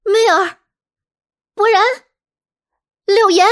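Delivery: high, loud shout of names; shouted names with gaps between; loud calling out. Acted. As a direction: call out for missing housemates, one name at a time. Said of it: "大喊道".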